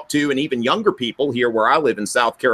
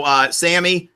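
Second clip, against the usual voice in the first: ordinary delivery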